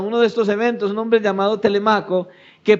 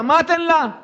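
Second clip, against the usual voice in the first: acted command; 8 semitones higher than usual. Father's death order, acted out.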